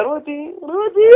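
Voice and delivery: high, drawn-out calling to a wife; excited calling. Retold or imitated. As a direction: call her name slowly, overcome with news. Husband excitedly calls his wife. (קריאה נרגשת ומתמשכת לאישה)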